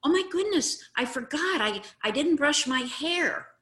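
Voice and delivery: bright, high, easygoing agreement; owning it with a laugh in the voice; cheerful agreeing reply. Shown as a demonstration. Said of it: "What I could say is".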